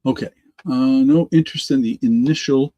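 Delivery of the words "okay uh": usual speaking voice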